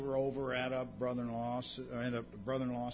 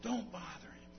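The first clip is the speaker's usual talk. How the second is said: shushing; 4 semitones higher, 6 dB louder, slower than usual. Retold or imitated. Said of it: "were telling him that".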